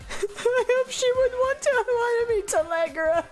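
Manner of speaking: tearful wail. Real, not acted. Missing friends after the event, tearful and wailing. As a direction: whine it out, half crying, half laughing.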